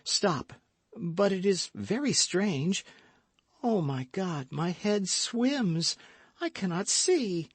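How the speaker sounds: a hint of a dazed, fading lady; lightly voiced faint young woman. Madame Bonacieux's dying lines.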